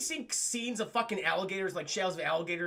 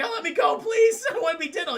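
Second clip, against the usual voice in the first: high, loud desperate begging; pleading kid; 9 semitones higher, 8 dB louder than usual. Retold or imitated. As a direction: beg to be let go. Kid begging Batman to release.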